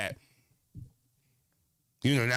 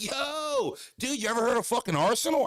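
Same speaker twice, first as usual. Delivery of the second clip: much higher, excited skater-bro voice; hyped skater dude; 11 semitones higher than usual. Retold or imitated. The fan asks about Arsenal in a high hyped skater voice. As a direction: fire the question off like a stoked kid.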